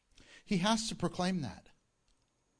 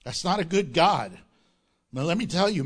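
normal talking voice

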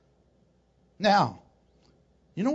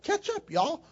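playful taunt; 9 semitones higher than usual. Retold or imitated. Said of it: "It's like".

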